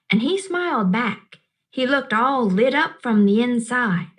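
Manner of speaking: plain reading voice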